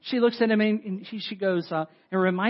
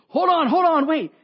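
much higher and louder call to stop them; 12 semitones higher, 10 dB louder than usual. Retold or imitated. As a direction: shout after the leaving couple. Waiter calls them back.

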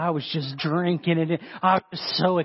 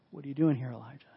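soft, lowered gentle question; quiet voice of God; still small voice; 4 semitones lower, 7 dB quieter, faster than usual. Acted. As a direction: ask it hushed, trailing almost to nothing. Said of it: "small voice".

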